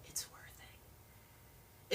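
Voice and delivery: hushed, drawn-out whisper; whispered aside. Real, not acted. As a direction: whisper it slowly like a secret. Confiding aside in pep talk.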